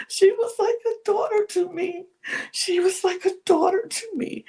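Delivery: high-pitched, hammed-up tearful wail; mock crying. Imitating Linda mourning Livy, mock crying. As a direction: imitate someone sobbing through the words. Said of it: "just burst out crying".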